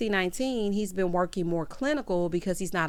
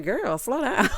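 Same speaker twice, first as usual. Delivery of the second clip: higher quip tipping into a laugh; breaking into laughter; 4 semitones higher, slower than usual. Retold or imitated. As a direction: crack up while calling after her.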